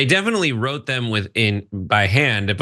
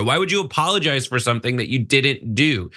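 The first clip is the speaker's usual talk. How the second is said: ordinary talk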